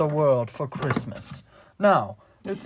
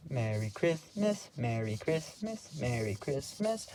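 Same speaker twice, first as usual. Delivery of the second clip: hushed, repeated greeting with a rising end; quiet, lightly acted elf greeting; soft festive greeting; 8 dB quieter than usual. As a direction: lilt it softly to each passer-by. Buddy wishing shoppers Merry Christmas.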